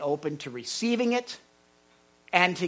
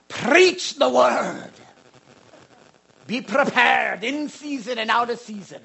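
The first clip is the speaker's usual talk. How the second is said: Scottish accent impression; 4 semitones higher, 5 dB louder than usual. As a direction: preach it the way Alistair Begg would. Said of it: "he talks like this".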